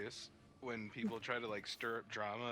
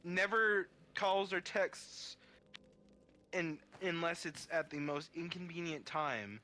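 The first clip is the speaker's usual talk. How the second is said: annoyed drawl; 5 semitones higher, slower than usual. Real, not acted. Complaining about dad.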